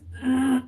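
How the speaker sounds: rough throat clear. Real, not acted. Throat clearing during reading.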